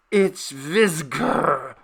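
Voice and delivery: very slow, muffled and thick; a dazed, choked, slow utterance; spoken thickly. Acted. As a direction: speak as through a stifling veil. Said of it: "he spoke thickly".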